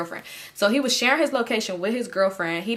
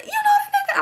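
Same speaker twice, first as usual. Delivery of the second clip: squeaky, much higher mock disbelief; hammed-up shocked boyfriend; faster than usual. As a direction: shriek it in disbelief. Enemy shocked, voiced very high.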